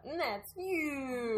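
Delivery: slow mock-presidential verdict; hammed-up politician's sign-off; Trump. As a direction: drag out "huge" as a parody. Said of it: "that was my Trump impression".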